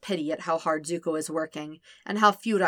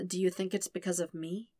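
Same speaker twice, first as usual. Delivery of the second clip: softer, guilty question; quiet; 6 dB quieter than usual. Acted. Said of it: "Sokka asks quietly".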